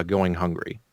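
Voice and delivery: ordinary talk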